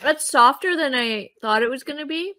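chewing while talking; mouth full of candy. Real, not acted. Seen 1: She bit the candy at -7; her jaw keeps working while she talks.

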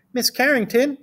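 high schoolgirl voice, asking; Emily Elizabeth. A narrator's reading. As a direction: pitch it up like a pupil calling on teacher. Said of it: "she asked".

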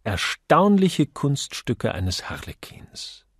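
usual speaking voice

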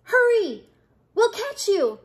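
high, loud urging; shouted. A narrator's reading. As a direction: shout up urgently to someone far above. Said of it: "The prairie dogs shouted".

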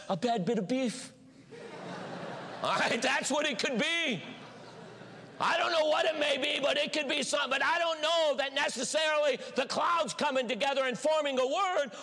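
normal talking voice

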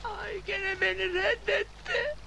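high, theatrical crying lament; sobbing, pitch climbing as it goes; tearful wail. Acted. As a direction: wail in despair at being rejected. Rejected suitor wails after refused kiss.

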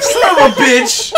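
loud high curse; yelling. Pretend. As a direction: shout the swear in panic. Patient soiling himself, cursing.

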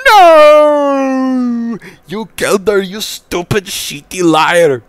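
long high screamed "No", then angry accusation; screaming. A narrator's reading. Mom accusing dad of killing her daughter, screaming. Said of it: "Caillou's mom screamed".

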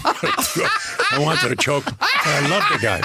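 high, slow laughing exasperation. Real, not acted. Recalling his mentor's criticism, laughing high and slow. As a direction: laugh through it fondly.

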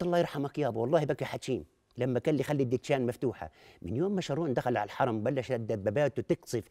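low, soft and mournful; somber. Acted. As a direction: remember him sadly. Sad remembrance of father.

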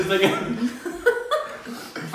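laughing hard through the words, slow and high. Real, not acted. Laughing at her friend's phrase, slow and high through laughter. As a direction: repeat it while cracking up.

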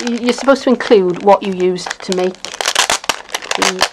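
ordinary talk

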